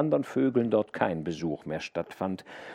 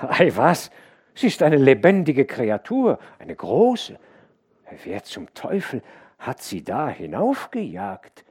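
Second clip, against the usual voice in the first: louder, higher farmhand, a bold front with dread underneath; uneasy bravado; 5 semitones higher, 6 dB louder than usual. A narrator's reading. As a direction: laugh it off while the fear shows through. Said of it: "Der andere lachte, wenn auch ein Grauen bei ihm hindurchklang".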